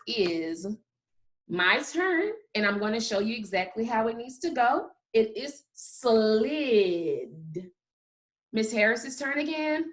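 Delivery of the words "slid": slid stretched right out, sound by sound; say it slowly. Shown as a demonstration. Teacher shows how it needs to go, very slowly. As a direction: draw out every sound in slid. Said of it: "it's time for say it slowly".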